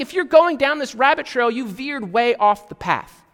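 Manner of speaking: loud mock rebuke as Paul; exasperated Paul. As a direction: warn them off the wrong path. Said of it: "He's like".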